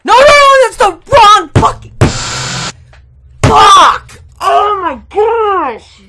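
high, loud, cracking yell of despair; anguished shout. Real, not acted. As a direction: scream it, near tears over the mistake. Makes wrong move and loses.

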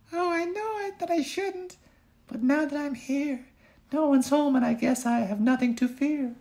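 put-on very high little-girl voice, half guilty; a naughty girl excusing herself; girlish Goldie. Goldie alone in the cottage, very high girlish voice.

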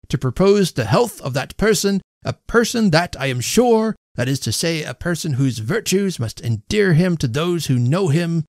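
high-pitched, emphatic after-dinner oratory; pompous speechmaker. A narrator's reading. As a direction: labour through a flowery toast. Host's toast speech.

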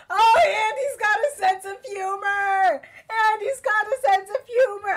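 very high, loud, stretched-out squeal; gushing squeal. Real, not acted. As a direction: squeal it, head over heels. Gushing over the teacher.

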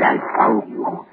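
ordinary talk

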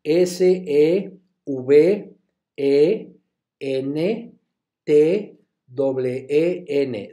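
seventeen spelled very slowly letter by letter; teacher spelling for students. Shown as a demonstration. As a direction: spell it out with long pauses. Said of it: "Se los deletreo".

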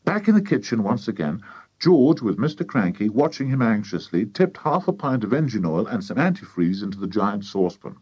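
usual speaking voice

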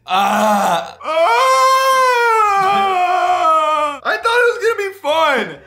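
high loud wailing cry; mock wail. Real, not acted. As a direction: wail like it's a tragedy. Wailing after whipped cream shot.

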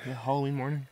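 normal talking voice

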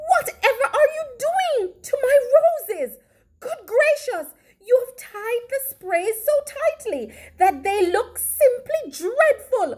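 high-pitched, indignant scolding voice; scolding woman. A narrator's reading. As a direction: exclaim in high, hurried dismay. Missus Puff scolds Meadow.

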